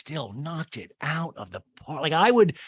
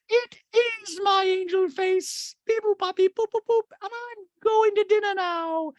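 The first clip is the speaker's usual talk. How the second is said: put-on very high, loud cooing baby talk; Dorit's baby talk; 4 dB louder than usual. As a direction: coo it to a toddler. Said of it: "And Dorit goes".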